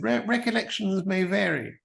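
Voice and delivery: high, measured regal impression; high-pitched royal voice; the Queen. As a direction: imitate a monarch's careful, elevated delivery. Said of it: "as the Queen memory said".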